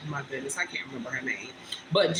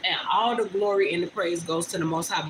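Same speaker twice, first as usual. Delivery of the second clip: ordinary talk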